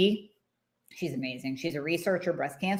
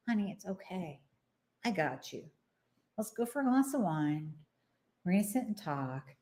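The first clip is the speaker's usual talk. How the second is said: softer, gentle comforting friend; soothing friend; 6 dB quieter than usual. Retold or imitated. Friend calms a frazzled woman, soft and soothing. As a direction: hush her down like a kind girlfriend. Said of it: "she comes in and she goes".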